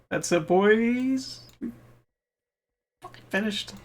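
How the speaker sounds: high, slow triumphant cheer; excited. Real, not acted. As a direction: savor the win out loud. Celebrating finishing grind.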